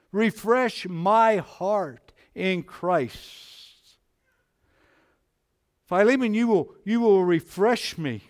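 loud, high, ringing pulpit preaching; impassioned full-voice sermon; preaching at full force. Acted. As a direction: lift the congregation, preaching hard. Preaching climax, raised and loud.

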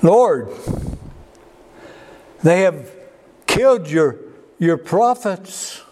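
high, pleading complaint to the Lord; Elijah's lament. Acted. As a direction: whine it out, self-pitying and hurt. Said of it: "Elijah answers".

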